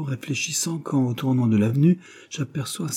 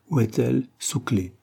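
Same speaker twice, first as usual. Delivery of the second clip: ordinary delivery, nothing marked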